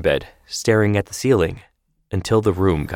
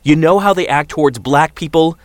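higher, louder rant; ranting father; 8 semitones higher, 5 dB louder than usual. Acted. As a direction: fire off the accusation. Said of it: "David ranted".

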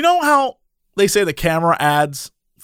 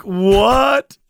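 mock disbelief; 2 semitones higher, 4 dB louder than usual. Reacting to her age confession.

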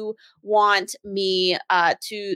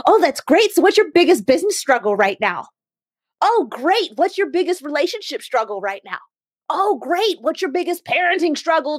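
high, mock-enthusiastic probing; hammed-up eager questioning; pushy networker; 4 semitones higher than usual. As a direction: imitate a salesy chatterer on repeat. Mimics pushy sales questions.

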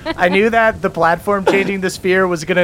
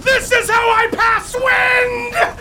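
high, slow, booming shout; booming queen shout; slower than usual. Acted. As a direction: bellow it as the air queen. Queen attacks the party.

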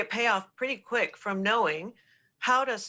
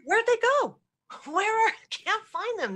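high-pitched playful puzzlement; mock searching; 11 semitones higher than usual. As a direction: act out hunting for someone missing, pitched way up. Voicing a boss seeking a hiding employee.

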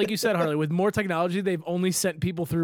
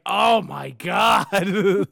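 exasperated groan; 4 dB louder, much slower than usual. Retold or imitated. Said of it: "you're like".

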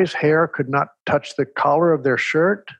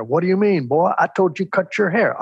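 imitating the principal giving orders, pitched up; bossy Texan principal; 5 semitones higher than usual. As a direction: play the coach who won't hear no. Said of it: "they talked like this".

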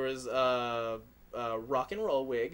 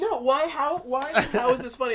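horrified amusement; 9 semitones higher, 6 dB louder than usual. Real, not acted. Mask wearer mock horror, loud.

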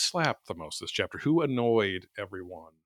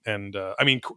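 ordinary talk

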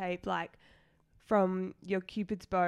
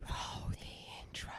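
whisper; 11 dB quieter, slower than usual. Real, not acted. Cueing the intro quietly.

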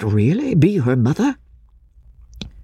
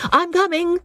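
calling out. A narrator's reading. Companion calling from afar.

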